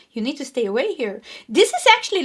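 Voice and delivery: ordinary talk, nothing marked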